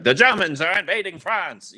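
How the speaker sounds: high, loud mid-Atlantic newsreel voice; 1940s newsreel announcer. Retold or imitated. Imitating the nineteen forties newsreader for a friend, high and loud. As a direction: belt it like a wartime radio newsman. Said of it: "I'm doing the guy who's like".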